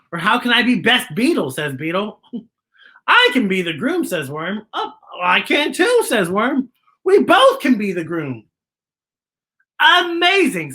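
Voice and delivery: lively, expressive picture-book narration; animated storyteller voice with playful character bits; reading to children. A narrator's reading. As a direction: read it like storytime for little kids.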